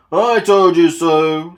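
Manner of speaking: loud, slow gloating sneer; rudely. A narrator's reading. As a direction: rub it in. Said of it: "said Gordon rudely".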